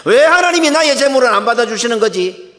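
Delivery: loud, high-pitched aggrieved outcry; indignant protest. Acted. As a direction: cry out Cain's grievance against God. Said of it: "가인은 항변하고 있습니다".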